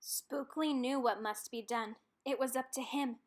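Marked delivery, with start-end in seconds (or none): none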